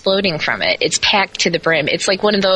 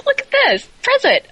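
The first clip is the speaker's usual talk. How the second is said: much higher, louder, sing-song fake delight; mock delight; 3 dB louder than usual. Recalling the delivered box, in mock high delight. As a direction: gush sarcastically over the gift. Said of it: "I'm like".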